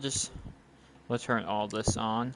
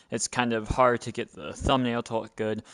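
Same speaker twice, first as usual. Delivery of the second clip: ordinary delivery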